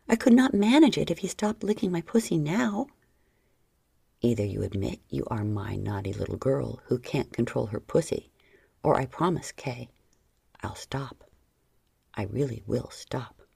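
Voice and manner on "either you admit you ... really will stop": low stern man voice. Acted. John's low threat.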